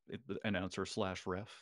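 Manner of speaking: ordinary talk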